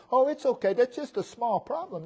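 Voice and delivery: high-pitched, dismissive reassurance; breezy minimizing. Retold or imitated. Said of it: "He's not minimizing the situation".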